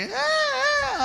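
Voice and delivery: high mock wail of a crying widow; imitated wailing. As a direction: squeal out a fake sob, very high. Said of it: "అని ఏడుస్తూ ఉంటుంది". (ఎత్తైన స్వరంలో వెక్కిరింపు ఏడుపు)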